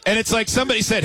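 ordinary talk, nothing marked

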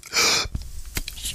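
strained extra gasp in; breathing for stethoscope. Shown as a demonstration. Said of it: "take another breath on top of it".

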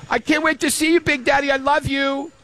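put-on high, sweet little-girl sign-off; Riley Kate. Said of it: "if she goes".

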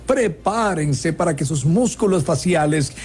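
presentador de circo pomposo, más agudo; ringmaster. Acted. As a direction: anuncia el número como en la pista. Presents the joke segment like a show. (voz de maestro de ceremonias)

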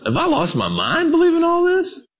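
high-pitched mock-frantic squeal; mock panic. Flustered parent doubting their own faith, mock panic. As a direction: shoot up high in hammed-up disbelief.

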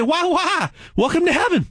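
put-on a playful Southern-accent Almighty welcoming you. Narrator plays God greeting new souls in a Southern accent. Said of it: "He might have a Southern accent".

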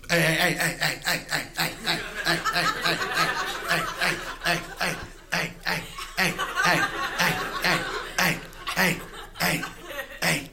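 short repeated barks made of words; talking dog bark. Acted. Dog in the front yard just talking, barking. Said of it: "all barking is, is talking".

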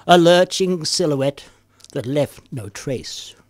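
slow, dramatic poetry reading; poem reading. Acted. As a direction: read it dark and dramatic. Reads dark poem aloud.

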